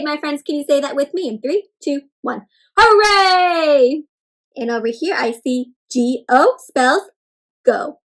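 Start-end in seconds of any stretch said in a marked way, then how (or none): 2.8-4.0 high, loud, stretched-out hooray; excited cheer. Shown as a demonstration. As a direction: cheer it out with joy. Cheers with the children.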